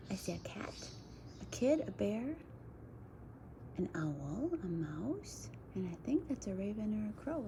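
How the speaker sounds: hushed, breathy whisper over the book. Real, not acted. Reading to kids, whispering what's on the cover. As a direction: whisper the names like a secret.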